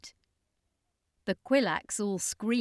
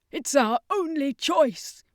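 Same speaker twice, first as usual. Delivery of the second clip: slow, louder and higher, stress on only; insistent; 7 semitones higher, 7 dB louder, slower than usual. Acted. Circus master insisting to Danica, slow firm. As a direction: press hard on only.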